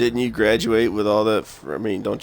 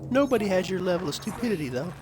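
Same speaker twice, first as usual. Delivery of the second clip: high mocking friend voice; 14 semitones higher than usual. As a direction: tease him in a squeaky voice.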